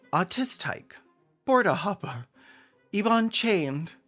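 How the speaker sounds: high, drawn-out proclaiming of names; mock roll-call announcement. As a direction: announce each name grandly, with a pause after. Reads out list of joke names.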